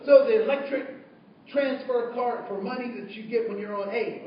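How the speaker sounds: ordinary talk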